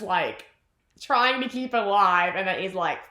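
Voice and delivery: ordinary talk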